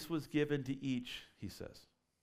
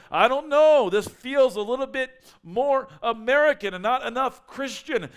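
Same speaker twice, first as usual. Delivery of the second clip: higher and louder, protesting; loud prophet complaint; 7 semitones higher, 10 dB louder than usual. Retold or imitated. Preacher voicing a prophet objecting to the church, loud and higher. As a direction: make noise about it. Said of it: "Prophets are going".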